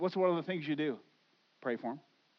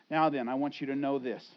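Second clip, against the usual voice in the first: ordinary delivery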